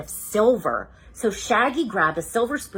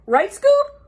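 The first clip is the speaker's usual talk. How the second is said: high rising ask; Shaggy; 8 dB louder than usual. Acted. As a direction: nudge the dog for backup. Shaggy asks Scooby.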